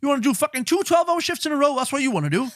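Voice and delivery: high jeering mock tough man; mock hard-man dad. Mocking valleys men telling a lad to stop crying, high jeer. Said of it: "It's always been like".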